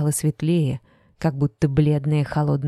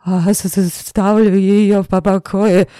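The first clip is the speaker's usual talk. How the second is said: higher, louder stammering babble; stuttering fear; 4 semitones higher, 7 dB louder than usual. A narrator's reading. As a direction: stutter it out terrified. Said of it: "заикаясь, пролепетал он".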